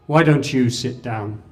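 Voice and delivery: loud call from the back of the hall; loud challenging call; voice ringing out. Retold or imitated. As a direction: call out loud across the room. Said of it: "a voice rang out from the back of the hall".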